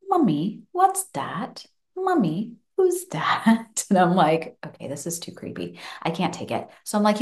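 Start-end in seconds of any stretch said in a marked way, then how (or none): 0.1-3.8 put-on toddler's higher, repeated questions; child's voice, higher; small child asking. Mum voices her son again. 4.7-6.7 much softer, muttered to herself; spooked under her breath; quiet unnerved aside; creeped-out aside. Retold or imitated. Mum creeped out by son.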